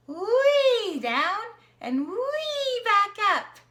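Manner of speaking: high, loud, slow wee like riding a slide; playful sliding wee for a child. Shown as a demonstration. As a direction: sing out the wee as you draw the V down and up. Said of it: "can you draw a V? It's like a slide".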